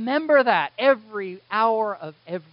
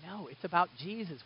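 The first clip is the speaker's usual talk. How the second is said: ordinary delivery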